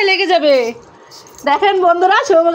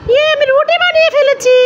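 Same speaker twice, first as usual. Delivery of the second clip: put-on high, louder proud little boy; child voice; 10 semitones higher, 4 dB louder than usual. Little son showing mummy his rotis, in a high proud child voice. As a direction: show off like a proud toddler.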